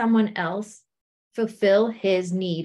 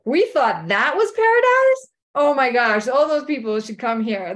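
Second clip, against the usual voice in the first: thrilled amazement; 5 dB louder than usual. Retold or imitated. Couple reaching the better place, loudly amazed. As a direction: burst out as if seeing fireworks.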